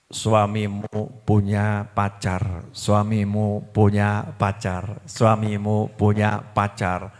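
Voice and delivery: low, slow devil whisper. Acted. Satan whispering to the wife, low and slow. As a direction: hiss it slowly like Satan tempting. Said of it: "Kemudian bisikin".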